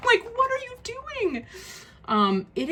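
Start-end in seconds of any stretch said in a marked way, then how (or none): 0.1-1.5 much higher, mock-pleading disbelief; incredulous. Real, not acted. Reviewer asks the publisher in high, incredulous disbelief. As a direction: ask it as if nobody could be that careless.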